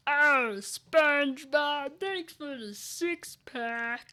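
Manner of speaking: loud, slow, happy cartoon voice; Squidward. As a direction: stretch it out like a pleased cartoon octopus. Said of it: "Happy Squidward".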